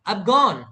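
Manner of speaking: "I've gone" said as one quick contracted unit; teacher modelling "I've gone" clipped and joined; contracted demo. Shown as a demonstration. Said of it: "American English में contraction use करते हैं".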